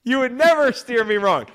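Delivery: ecstatic, high, loud victory shout; celebratory yell. Real, not acted. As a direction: yell in triumph at a won bet. Celebrates a winning bet.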